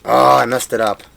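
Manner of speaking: sudden loud oh I messed up; loud dismay. Real, not acted. Cuber botches his move on camera, loud dismay. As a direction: blurt it out, caught off guard.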